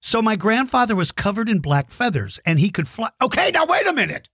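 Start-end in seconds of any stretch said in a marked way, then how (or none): 3.2-4.3 surprised. Real, not acted. Catching his own mistake.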